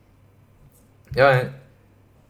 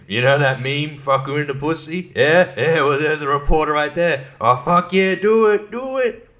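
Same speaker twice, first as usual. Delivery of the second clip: put-on rowdy frat boys; 4 semitones higher than usual. Frat boys shouting at a reporter from a car, building excitement. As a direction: egg each other on like drunk frat boys. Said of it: "frat boys driving by like".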